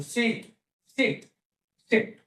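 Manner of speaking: "सीट" said carefully on its own; pronunciation demo. Shown as a demonstration. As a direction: model "सीट" as a separate word for pupils. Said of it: "इसको सीट कैसे बोल सकते हो".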